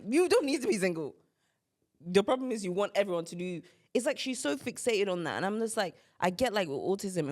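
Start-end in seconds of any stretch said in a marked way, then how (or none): 0.1-1.2 exasperated retort. Retold or imitated. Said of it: "I'm like".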